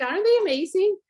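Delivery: retelling her own excited gush to the viewers; gushing delight. Retold or imitated. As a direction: beam about them like a proud kid. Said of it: "I talked about my earrings and I'm like".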